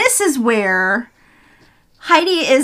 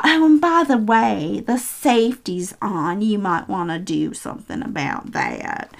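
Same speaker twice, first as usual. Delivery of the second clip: imitated bitchy Southern cat, trailing lower and softer; southern accent; 3 semitones lower, 6 dB quieter than usual. Cat taunting Heidi about the safety, bitchy Southern accent. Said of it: "she had this southern accent".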